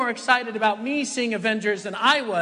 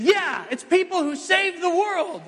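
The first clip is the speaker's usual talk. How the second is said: put-on much higher child voice, eager; kids' answer; 10 semitones higher, 3 dB louder than usual. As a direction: chime in like confident kids. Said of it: "they said".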